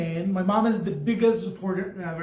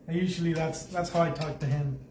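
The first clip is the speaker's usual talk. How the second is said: ordinary talk, nothing marked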